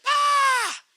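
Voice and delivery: high loud wail; mock boy wail; little boy's cry. Shown as a demonstration. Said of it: "Little boys do".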